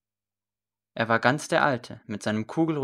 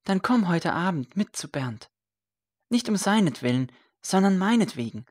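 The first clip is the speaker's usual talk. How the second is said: put-on a higher, persuasive young man's voice; lightly voiced earnest son; 7 semitones higher, 3 dB louder than usual. A narrator's reading. Son urging his old mother to come tonight, pleading lightly. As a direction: coax her gently but eagerly.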